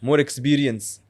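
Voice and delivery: put-on broken-English father character accent; lightly voiced old father with a heavy accent; Abu Zubair accent. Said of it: "He has an accent".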